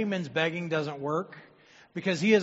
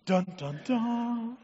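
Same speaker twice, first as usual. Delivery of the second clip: dramatic dun dun dun; 5 semitones higher, slower than usual. Acted. Dramatic sound effect.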